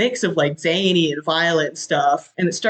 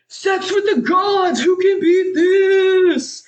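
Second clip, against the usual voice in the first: high, slow, loud whooping shout; ecstatic shout; 12 semitones higher, 4 dB louder, much slower than usual. Retold or imitated. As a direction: yell it mid-freefall in pure bliss. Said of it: "One guy's like".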